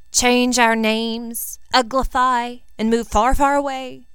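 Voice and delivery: slow, dragged-out gloomy lament. A narrator's reading. Ginny to Draco on their fix, slow gloomy lament. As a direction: drawl it out in dramatic despair. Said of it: "she said gloomily".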